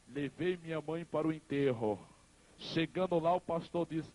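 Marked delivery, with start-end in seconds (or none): none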